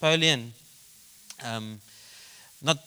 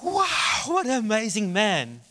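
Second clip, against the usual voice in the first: very high, acted cry of wonder; amazed delight; 8 semitones higher, slower than usual. Daughter admiring her father's painting, acted high amazement. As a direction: imitate an awestruck little girl, pitched way up.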